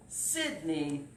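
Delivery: ordinary delivery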